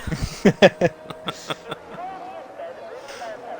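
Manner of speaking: breathy high-pitched laugh; high laughter. Real, not acted. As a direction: burst out laughing. Laughing at the long name.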